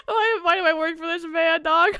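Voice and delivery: mock anguished complaint; laughing lament. Real, not acted. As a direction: despair through giggles.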